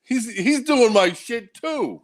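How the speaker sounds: high, drawn-out mock indignation; retold outcry. Comic retelling Russell's reaction at a party, high mock outcry. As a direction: whine it high and slow, half laughing. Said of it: "And he's like".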